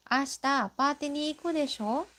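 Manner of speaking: high, fast, desho lifted at the end; rising question tune. Shown as a demonstration. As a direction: ask "Ashita pati ni iku desho?" with a rising tune on desho, checking for agreement. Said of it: "Said with the rising intonation to confirm".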